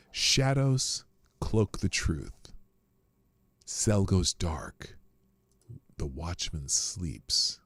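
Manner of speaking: slow, sinking dramatic recitation; slow, falling toward a hush; dramatic poem reading. Acted. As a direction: read it like ominous poetry.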